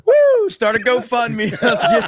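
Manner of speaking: loud joking whoop; excited cheer. Real, not acted. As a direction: cheer it up high. Cheering a GoFundMe.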